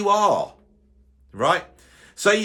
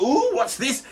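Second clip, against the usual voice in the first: delighted surprise; 7 semitones higher, slower than usual. Retold or imitated. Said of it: "and I'd like".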